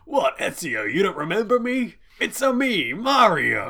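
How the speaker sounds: loud, high, hammed-up Italian accent; Uncle Mario. Quoting a game uncle greeting his nephew, exuberant mock Italian accent. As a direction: boom it out in sing-song cartoon Italian. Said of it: "your Uncle Mario and it's like".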